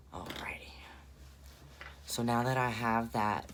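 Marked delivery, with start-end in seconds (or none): 0.1-0.7 whispered to self. Real, not acted. Muttering to self mid-tutorial.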